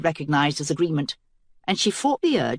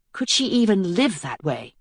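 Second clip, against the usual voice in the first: ordinary delivery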